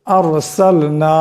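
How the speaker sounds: আরসালনা with a heavy, full-mouthed র, drawn out slowly. Shown as a demonstration. Teacher drawing out আরসালনা slowly with a heavy র for students. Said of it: "এমন করে".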